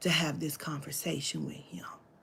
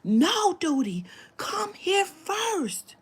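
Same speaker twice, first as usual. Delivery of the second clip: slow, stretched, higher call; mock scream; 11 semitones higher, much slower than usual. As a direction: drag it out like a frantic girl. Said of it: "the girl kept screaming".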